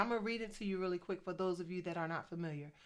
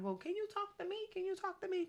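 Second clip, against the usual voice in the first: much higher, pleading, imitating a younger self; retelling a plea to God, higher; mock plea to God; 11 semitones higher, faster than usual. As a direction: beg up high like a hopeful kid.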